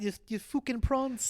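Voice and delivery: attempted South African accent that drifts Irish; a rough South African accent, almost Conor McGregor. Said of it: "a South African accent".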